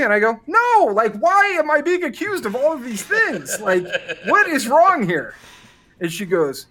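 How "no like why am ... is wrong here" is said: high-pitched, exasperated disbelief; acted-out bewildered indignation; indignant protest. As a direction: protest a baffling accusation, pitch jumping up. Retells protesting the dancer's accusations.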